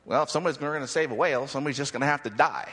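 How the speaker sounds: low, fast mock old man; a hint of the gruff old whale warrior; old dude again. Preacher re-quotes the Whale Wars old man, lightly voiced. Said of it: "I mean".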